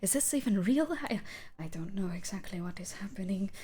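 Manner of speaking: anxious questions, swinging from breath to pleading; bewildered, rising into anguish; distressed figure. Acted. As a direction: plead in confusion, half-whispering. Morphing figure's anxious thoughts.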